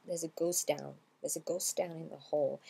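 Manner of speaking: "ghost down" run together as "ghos-down"; T merged into D. Shown as a demonstration. Teacher demonstrates the result, "ghost down" blended with the T dropped. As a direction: link "ghost" to "down" without sounding the T. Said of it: "On va le mélanger".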